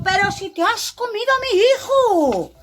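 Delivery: high, loud outraged cry; hammed-up furious father; very angry. A narrator's reading. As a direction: explode in indignation, pitch way up. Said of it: "muy enfadado".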